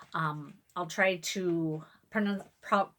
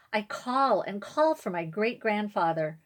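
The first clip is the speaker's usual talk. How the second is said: ordinary delivery, nothing marked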